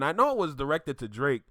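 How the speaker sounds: usual speaking voice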